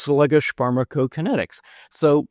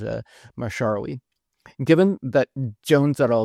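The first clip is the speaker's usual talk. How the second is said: ordinary talk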